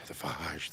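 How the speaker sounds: phage with an open "ah", like "fahzh"; trying out pronunciations. Shown as a demonstration. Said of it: "I don't know what the hell they call it".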